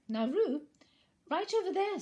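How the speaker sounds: put-on mother reining in her child; Kanga calling. Kanga calls Roo.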